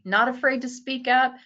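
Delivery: usual speaking voice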